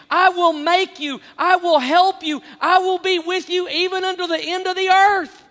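high, loud climactic preaching; fervent preaching. Acted. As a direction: build to a loud, ringing climax. Preaching at full force.

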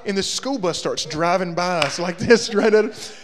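normal talking voice

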